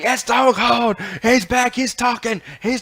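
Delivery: a hammed-up Vince McMahon shout. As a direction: hype it up like Vince selling the moment. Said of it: "Vince is just like".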